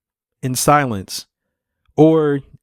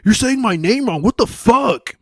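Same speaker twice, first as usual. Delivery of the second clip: higher, hammed-up mock outrage; fake annoyance; 10 semitones higher than usual. Faking irritation when people say his name wrong, mock outraged. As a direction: pretend to be fed up. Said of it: "I used to pretend like it was like".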